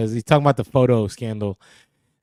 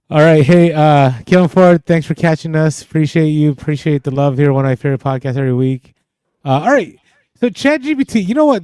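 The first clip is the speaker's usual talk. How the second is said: much louder, coming in hot; too loud on the mic; 2 semitones higher, 8 dB louder than usual. Real, not acted. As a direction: boom into the mic with energy. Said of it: "give a little bit of space on the mic when you're coming in hot".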